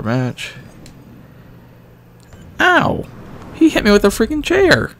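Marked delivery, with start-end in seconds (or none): 2.6-4.9 outraged yelp. Real, not acted. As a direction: shriek in mock pain and outrage. Opponent hits him with chair.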